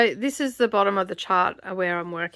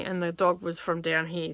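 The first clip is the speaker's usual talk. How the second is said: normal talking voice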